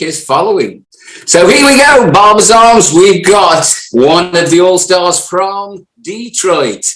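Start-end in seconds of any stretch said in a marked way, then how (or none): 1.3-6.9 big, slow, higher hype announcement; announcer intro. Acted. Host welcoming the guest in with a loud announcer call. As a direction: bring the guest on like a ring announcer.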